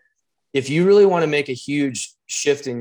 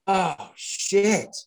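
higher, drawn-out imitation of sudden realization; dawning realization; 7 semitones higher than usual. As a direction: act out the moment it sinks in. Acts friend's sudden realization.

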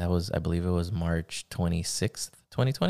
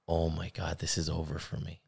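hushed dread; 7 dB quieter than usual. Retold or imitated. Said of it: "I was just like".

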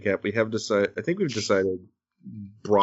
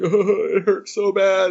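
put-on high, loud whiny wail of a hurting child; hammed-up childish whimper; mock crying kid; 10 semitones higher, 6 dB louder, slower than usual. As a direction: imitate a daughter sobbing over a loose tooth. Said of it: "she's crying".

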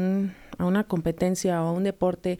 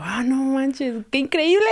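amazed exclamation; 7 semitones higher than usual. Retold or imitated. Coach marvelling at girls landing a move she never could, amazed. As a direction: burst out in awe, pitch soaring.

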